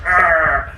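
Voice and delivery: loud effortful strain; straining grunt. Retold or imitated. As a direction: grunt as if forcing a stuck lid open. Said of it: "big strong man's like".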